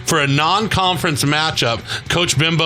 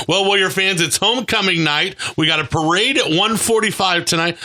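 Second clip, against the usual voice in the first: radio announcer; 4 semitones higher than usual. Acted. Host turns to announce the evening.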